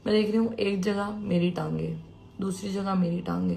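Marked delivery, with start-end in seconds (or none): none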